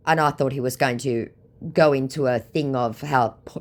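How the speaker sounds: normal talking voice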